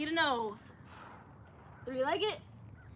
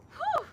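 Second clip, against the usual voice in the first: relieved whew. Real, not acted. Exhale after a hard jump.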